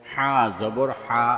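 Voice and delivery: final "হা" cut short; quick short vowel. Shown as a demonstration. Teacher demonstrating normal jabar, short "হা". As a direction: keep the last "হা" brief, not held. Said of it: "তাড়াতাড়ি করতে হয়".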